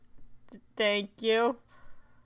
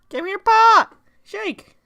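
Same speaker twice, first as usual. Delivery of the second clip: much louder, high shout; loud command; 10 semitones higher, 18 dB louder than usual. Real, not acted. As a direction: order the dog loudly. Commanding the dog.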